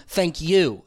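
"thank you" with a full, weighted "you"; stress on YOU. Shown as a demonstration. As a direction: land firmly on "you" and let it fall. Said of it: "We need the full you".

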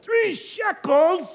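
high, slow, drawn-out disbelief; incredulous haggling traveler; shocked at price. Acted. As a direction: stretch it out as if the price is outrageous.

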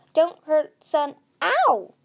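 high, loud yelp of protest. A narrator's reading. Dragon pinned by three guards, yelping. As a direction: shout out, cut off by pain. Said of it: "Clay yelped".

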